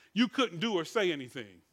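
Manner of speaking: ordinary talk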